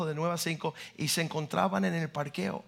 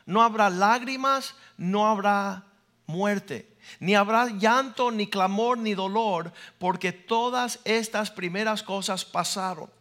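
forceful preaching, louder on the opening lines; a preacher declaiming a promise of heaven; 4 dB louder, slower than usual. Acted. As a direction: proclaim it from the pulpit. Preacher proclaims scripture.

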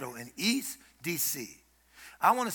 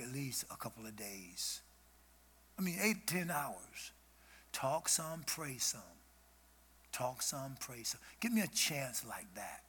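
soft intimate talk; 5 semitones lower, 9 dB quieter than usual. Real, not acted.

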